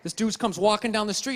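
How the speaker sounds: usual speaking voice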